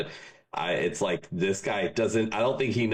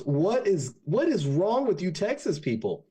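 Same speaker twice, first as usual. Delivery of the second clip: higher, fed-up complaint; exasperated Devil; 9 semitones higher than usual. Acted. The Devil giving up on the Texans, higher and exasperated. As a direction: throw up your hands at them.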